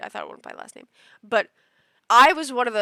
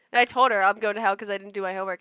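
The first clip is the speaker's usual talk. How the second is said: normal talking voice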